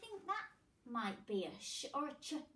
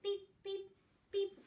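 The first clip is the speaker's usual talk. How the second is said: machine beeps; 8 semitones higher than usual. Pretend.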